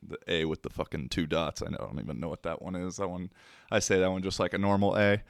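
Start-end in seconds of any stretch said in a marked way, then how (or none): none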